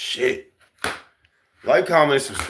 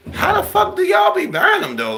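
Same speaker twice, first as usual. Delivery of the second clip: incredulous shout. Real, not acted. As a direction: shout it in disbelief. Incredulous outburst.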